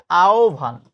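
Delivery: আহ্বান said as "aobhan", with a gliding "ao"; correct pronunciation. Shown as a demonstration. Teacher modelling the standard form for students, correct আহ্বান as "aobhan". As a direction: draw out আহ্বান clearly as "ao-bhan". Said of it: "আহ্বানের প্রমিত উচ্চারণ হচ্ছে".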